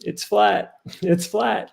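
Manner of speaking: chuckling happy confirmation; bright and cheery. Retold or imitated. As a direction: cheerfully repeat it's flat with a laugh. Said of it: "he was bright and cheery".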